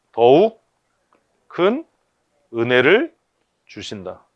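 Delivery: each word set apart by pauses; 더욱 큰 은혜를 read word by word; spaced-out scripture read; emphatic reading. Shown as a demonstration. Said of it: "시작".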